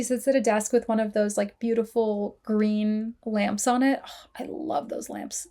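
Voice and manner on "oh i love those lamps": soft, whispery gush; hushed adoration. Real, not acted. Podcaster gushing over beautiful green lamps, hushed and adoring. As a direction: swoon softly, almost under your breath.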